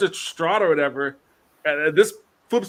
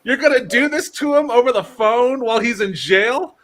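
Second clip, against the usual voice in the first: high, disbelieving "Really?" then the rest in a raised voice; incredulous disbelief; 7 semitones higher, 3 dB louder than usual. Retold or imitated. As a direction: ask it as if you can't believe what you just saw. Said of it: "I was like".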